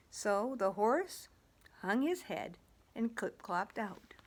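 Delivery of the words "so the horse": usual speaking voice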